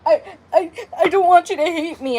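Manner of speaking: mock sobbing, words breaking up; a retold, half-laughing cry; imitating crying boyfriend. Boyfriend crying on the phone to her, mock sobbing. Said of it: "and he was crying".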